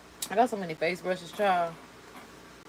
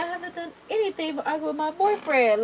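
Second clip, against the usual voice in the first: a higher-pitched quote of the woman, lightly voiced; imitating her; 9 semitones higher than usual. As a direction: echo her protest in a raised, imitating voice. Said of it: "She said".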